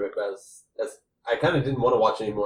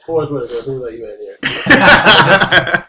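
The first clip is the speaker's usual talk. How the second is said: laughing; 2 semitones higher, 12 dB louder, much slower than usual. Real, not acted. Laughing reaction to the impression.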